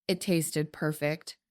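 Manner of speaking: plain reading voice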